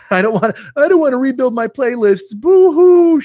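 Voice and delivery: high, loud fake crybaby wail; mock whining. Mocking his own first-world problems, mock crybaby whine.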